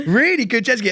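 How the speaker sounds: usual speaking voice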